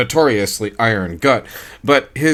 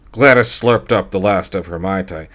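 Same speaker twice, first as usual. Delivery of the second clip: plain reading voice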